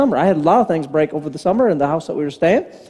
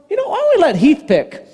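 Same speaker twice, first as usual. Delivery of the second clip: higher, lightly voiced kid ducking the choice; voicing sly son; 9 semitones higher than usual. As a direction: play the kid slipping out of it. Son dodges, voiced in story.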